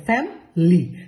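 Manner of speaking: ফ্যামিলি as two syllables, "fam-ly"; native pronunciation. Shown as a demonstration. Teacher modelling the native form, ফ্যামিলি as "fam-ly". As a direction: drop the middle vowel in ফ্যামিলি, "fam-ly". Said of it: "আমি যখন F A M".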